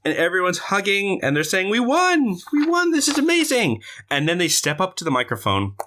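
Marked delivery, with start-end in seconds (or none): 1.7-3.8 high, elated victory cries; jubilant. Acted. Heroes hugging after they won, jubilant. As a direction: celebrate in a high, thrilled voice.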